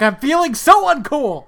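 high, loud mock outburst; retold yell. One host imitating Simeon repeating the yell, mock shouting. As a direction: yell it in hammed-up frustration. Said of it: "word for word yells what he yelled".

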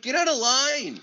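high-pitched, exasperated retold command; mock outrage at a line cutter; indignant order.

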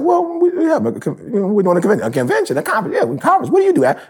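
high, prim questioning lady; high-pitched mock lady asking questions. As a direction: play the nosy lady high and sweet. Voicing the Michigan lady.

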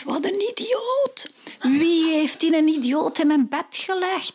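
high, exasperated inner voice; wife thinking her husband is an idiot; exasperated thought. Acted. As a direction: roll the eyes in your head.

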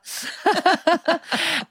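high burst of laughter; squealing laugh at own joke. Real, not acted. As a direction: crack up high and bright.

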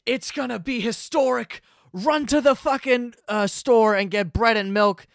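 high, loud, urgent forecaster, slowing as it rises; mock meteorologist. TV meteorologists warning New Yorkers about snow, mock alarm. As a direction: hype it like doom news. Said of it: "they go".

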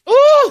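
squealing ooh; high flirty ooh. Acted.

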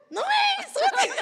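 chillido apremiante, muy agudo; insistencia apremiante imitada. Retold or imitated. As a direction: imita a un amigo desesperado por apurarte. (insistiendo con voz agudísima)

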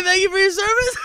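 high, loud, laughing mock outrage; indignant laughing quote. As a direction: squeal it through laughter, mock offended. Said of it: "I'm supposed to say".

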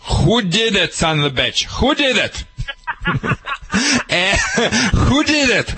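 scolding the dog in a mock Russian accent. Shown as a demonstration. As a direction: play a stern Russian asking who did it. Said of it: "with the Russian accent".

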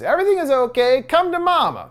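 high, sing-song motherly coo. A narrator's reading. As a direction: coo it like a mother luring a child. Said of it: "She coos".

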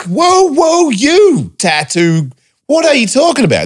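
high, squeaky mock disbelief; hammed-up disbelief, pitched high; incredulous reply. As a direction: play up your own baffled reaction. Mimicking his own reply.

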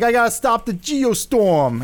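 put-on high, slow, drawn-out New York accent; hammed-up New York accent; hardcore New York accent. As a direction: mimic an Englishman overdoing New Yorker. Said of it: "a very hardcore New York accent".

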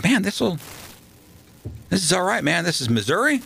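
a light, high-pitched show of amazement; joking amazement. Retold or imitated. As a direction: marvel at the place, teasing yourself. Mocking his Missouri expectations.

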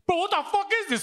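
high panicked outburst; imitating his friend's high alarmed cry of disbelief; freaking out. Friend confused by messages from guys, high panicked cry.

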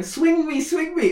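put-on high pleading child voice; squeaky kid plea; child begging. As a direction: beg like a little girl wanting another swing.

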